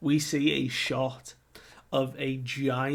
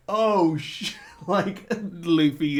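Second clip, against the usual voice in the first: retold alarm; 4 semitones higher, 5 dB louder, slower than usual. Group reacts to Luffy running off.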